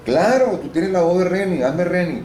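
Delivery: high, loud mock urging; imitating Rosario. Retold Rosario insisting he play Reni, high and pushy. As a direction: voice the friend pushing him, pitched high.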